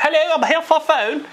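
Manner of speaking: high, hammed-up British accent; put-on British accent. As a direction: fake a Brit with a comically high voice. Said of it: "if I have an accent".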